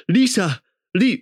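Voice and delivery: high, mock-cartoon cry of the name; South Park-style voice. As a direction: imitate a strange cartoonish American accent, high. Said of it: "like, some South Park shit".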